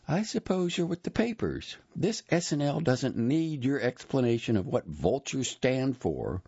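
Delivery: high-pitched, dismissive woman's voice; curt female employee. A narrator's reading. Same woman pops back out, scolding.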